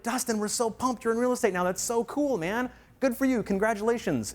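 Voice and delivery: put-on old friends happily congratulating Dustin on his new career; cheerful friends. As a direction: sound genuinely pumped for him.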